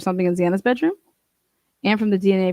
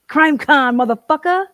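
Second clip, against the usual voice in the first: loud, high, thrilled cheer; excited shout; 7 semitones higher, 5 dB louder than usual. Real, not acted. Streamer cheering to chat about going to Crime Con, excited shout. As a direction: shout it out like you just got tickets.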